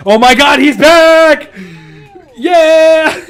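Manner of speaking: high, loud squeal of joy; excited cheer. Real, not acted. Player cheering a friend's return, excited squeal.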